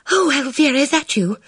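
put-on high, anxious girl's voice; breathless and worried on the phone; agitated. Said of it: "Bridget's voice sounded agitated".

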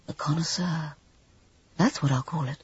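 softly whispered to himself; muttered. A narrator's reading. Alan names his new picture to himself, muttered. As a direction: breathe the title under his breath. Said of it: "muttered Alan Everard".

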